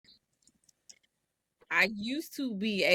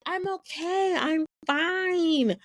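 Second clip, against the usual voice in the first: high, breezy, false I'm fine; fake cheerful; 10 semitones higher, slower than usual. Insisting to herself she's okay, high cheerful denial. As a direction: chirp it like all is well. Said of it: "But I'm still like".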